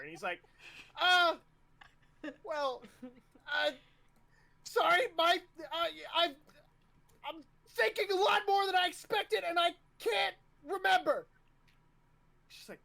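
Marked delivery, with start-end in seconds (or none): 1.0-11.3 high, loud, flustered cadet voice; nervous stammering. Acted. Flustered cadet explaining himself to the officer, nervous and high. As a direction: stumble through an apology, voice pushed way up. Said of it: "you can tell there's this nervousness".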